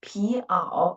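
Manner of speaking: "Pi ao" said as "pi", then "ao"; two syllables. Shown as a demonstration. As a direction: separate "Pi" from "ao". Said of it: "there are two syllables".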